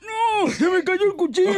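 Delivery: high, strained voiceless cry; hoarse tiger. Acted. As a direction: call out like a tiger who lost his voice. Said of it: "Y dice Tarzán". (tigre afónico, voz ronca y aguda)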